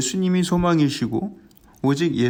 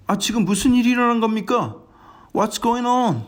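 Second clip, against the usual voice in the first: urgent question; 7 semitones higher than usual. Acted. 맹인이 다급히 묻는 장면.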